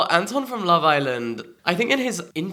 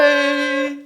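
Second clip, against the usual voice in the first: talking to a cat; 13 semitones higher, 4 dB louder than usual. Real, not acted. Greeting a cat.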